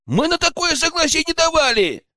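very high, outraged objection; protesting in chorus. A narrator's reading. Two lads object to their boss in unison, protesting. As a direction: squeal out a joint protest. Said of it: "хором с удивительной синхронностью попытались".

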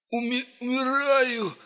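high, slow, choking on blood; gurgling. Acted. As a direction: gasp it out through gurgling. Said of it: "пробулькал раненый отморозок".

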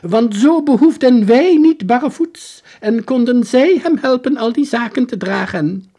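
put-on high, loud, bewildered old soldier. Soldier pleading his story to the detective, high and loud. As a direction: pitch him up high and loud as the lost soldier.

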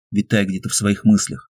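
ordinary delivery, nothing marked

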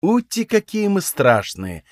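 high, slow cooing mockery; clowning, sing-song fake awe; mocking baby talk. A narrator's reading. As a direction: coo it like teasing a toddler. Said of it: "произнёс я, кривляясь".

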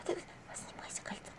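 very soft, voiceless whisper; whispered spell. A narrator's reading. Vasilisa whispering to the ring, hushed incantation. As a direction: whisper coaxingly under the breath. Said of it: "пытаясь нашептать на колечко".

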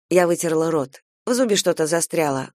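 ordinary delivery, nothing marked

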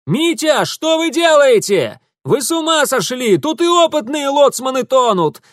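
high, loud alarmed shout; yelling after someone. A narrator's reading. Urusov yells after Mitya running to the river, alarmed shout. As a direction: holler across a growing distance. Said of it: "заорал ему вслед Урусов".

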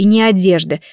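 usual speaking voice

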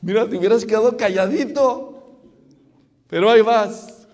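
loud, high exasperated reproach; hammed-up exasperation at his blunder; mock scolding. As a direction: scold him loudly for blundering in. Preacher mock-scolds the husband.

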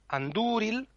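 Andúril with a long, drawn-out Ú; long vowel. Shown as a demonstration. Teacher shows the Elvish long vowel, Andúril with Ú held. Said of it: "es si una vocal es larga".

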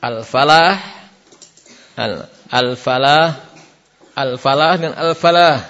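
al-falah said twice, clear h then throaty ḥ; al-falah versus al-falah; contrast ha sounds. Shown as a demonstration. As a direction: split the two al-falah apart. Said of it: "Bedakan antara".

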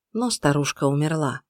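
usual speaking voice

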